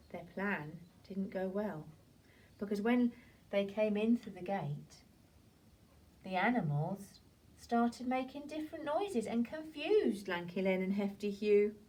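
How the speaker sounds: normal talking voice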